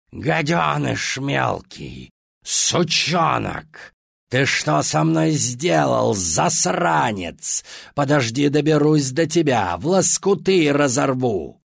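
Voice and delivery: high, loud, slow savage shriek; furious monster scream. Acted. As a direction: scream it like a tortured beast. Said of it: "надсаживался в яростном диком крике".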